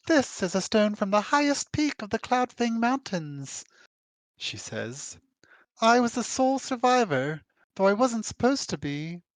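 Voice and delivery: high, proud voice ending in mock laughter; high heroine voice with a mock cackle; Rija's voice. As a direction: boast of it, then laugh it off.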